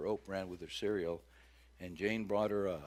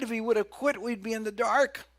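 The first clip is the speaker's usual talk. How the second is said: loud, high preaching; fervent pulpit preaching; preaching at full force; 10 semitones higher, 9 dB louder than usual. Acted. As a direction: drive it home to the congregation. Preacher building to a peak.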